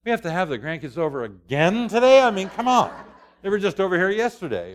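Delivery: hammed-up husband at his worst; grouchy complaint. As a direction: whine about having company, mock-indignant. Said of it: "You don't see me when I'm going".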